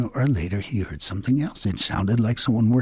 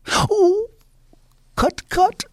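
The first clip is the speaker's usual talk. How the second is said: much higher, louder crying wail; 4 dB louder, much slower than usual. Acted. Rabbit wailing while Coyote cuts off his tail. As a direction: wail as your tail is snipped.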